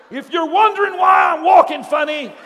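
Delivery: loud, high public announcement; blurted confession. Retold or imitated. Evangelist confessing to the congregation, loudly blurted. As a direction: blurt it out at full volume.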